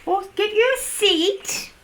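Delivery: high, loud gushing welcome; excited welcome. Pretend. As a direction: greet Santa like the best surprise ever. Greeting Santa's arrival.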